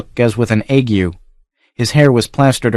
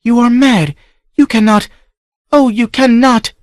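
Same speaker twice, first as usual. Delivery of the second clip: desperate protest; 14 semitones higher, 4 dB louder than usual. Acted. Niece pleads against the whipping.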